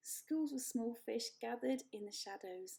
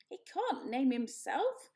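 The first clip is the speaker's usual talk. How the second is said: squawking goose; 2 semitones higher, 5 dB louder than usual. A narrator's reading. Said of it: "squawked Loudwing".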